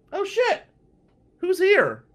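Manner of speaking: high, loud panicked yelp; panicked shock. Retold or imitated. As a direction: blurt it out in sudden alarm. Panicked inner thought.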